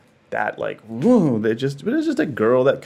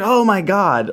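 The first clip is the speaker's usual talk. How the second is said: surprised realisation; 9 semitones higher, 4 dB louder, slower than usual. Retold or imitated. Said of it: "I was like".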